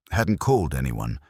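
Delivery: ordinary delivery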